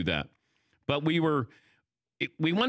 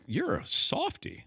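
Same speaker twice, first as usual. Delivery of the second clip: teasing high voice; 13 semitones higher, 3 dB quieter, slower than usual. Retold or imitated. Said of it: "Robin Roberts saying after".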